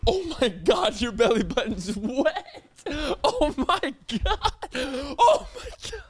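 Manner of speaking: high, slow, wheezing laughter; laughing till it hurts; hysterical laughing. Real, not acted. Tears dripping, laughing.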